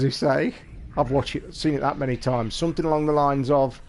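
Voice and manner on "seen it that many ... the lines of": ordinary talk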